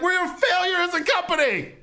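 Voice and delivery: very high, strained wail; hammed-up cry of despair; mock meltdown. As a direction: wail it at the very top of your range. Climax of acted meltdown.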